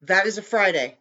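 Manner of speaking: usual speaking voice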